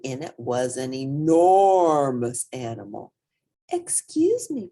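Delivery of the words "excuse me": put-on high, small child voice for Portly; squeaky polite kid; little hippo voice. A narrator's reading. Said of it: "Portly said".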